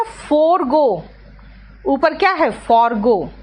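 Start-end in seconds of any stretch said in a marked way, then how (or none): 0.0-1.0 forgo stretched very slowly; drawn out. Shown as a demonstration. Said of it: "उसके बाद जो word है ना".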